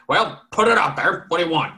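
a hint of a gruff old farmer; spraying foam while talking.

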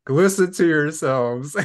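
retold incredulous scolding; exasperated mock plea. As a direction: plead with them to hear how absurd they sound. Acting out exasperated disbelief at others.

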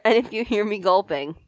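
ordinary talk

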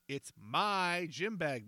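slow, emphatic insistence on my; slow stubborn insisting; insisted. A narrator's reading. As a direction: lean hard on my, slowly. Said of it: "Baldy insisted".

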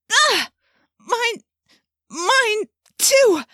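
very high, shrill alarmed yelps; high panicked cry. A narrator's reading. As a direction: yelp in sudden shock. Wife slapped by own hand.

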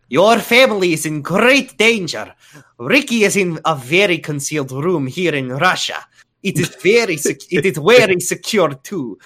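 lightly put-on Russian accent. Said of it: "Russian accent".

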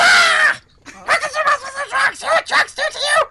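high, loud squawking duck rant; Donald Duck voice. Acted. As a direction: yell it like a furious cartoon duck. Donald Duck rant about drugs.

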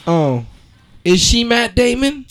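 ordinary talk

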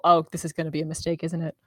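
low, quick mumble; softly muttered misgiving under the breath; mumbling. Acted. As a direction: mumble it to yourself, soft and fast. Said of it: "she's probably gonna mumble".

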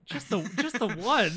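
loud, high incredulous echo; mock disbelief. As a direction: repeat it loudly, as if astounded. Incredulous repeat of bartender's answer.

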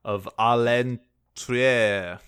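À l'entrée said slowly in a halting, unsure French attempt; mangled French. Shown as a demonstration. Host admits no French while mangling À l'entrée, slow. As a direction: sound out À l'entrée syllable by syllable like a non-speaker. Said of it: "Have I talked about how I don't speak French?".

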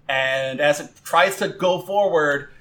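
ordinary talk

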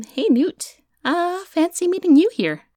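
high, loud, overly casual hello; bluffing that the meeting is chance; fake surprise. Caught, faking a casual surprise.

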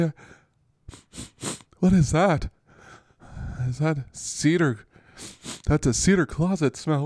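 high, confused voice of a woozy player; befuddled concussed receiver in a closet; dazed concussed player. Acted. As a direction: play a groggy, lost athlete.

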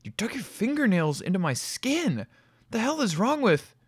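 high, furious outburst. A narrator's reading. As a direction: lash out in shock and anger.

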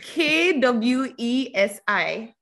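spelling out. Shown as a demonstration. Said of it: "How do you spell this".

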